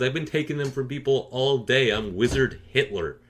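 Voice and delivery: normal talking voice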